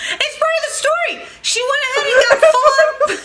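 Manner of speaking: high, laughing protest; laughing while defending the story. Real, not acted. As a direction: squeal it through laughter.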